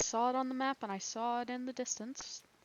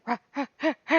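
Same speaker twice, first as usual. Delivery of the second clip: loud, higher mock squawking rahs; imitating angry ducks; 6 semitones higher, 12 dB louder, much faster than usual. Gamer imitating ducks scaring each other, loud squawks. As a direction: squawk like riled-up birds. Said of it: "They scare 'em. It's like".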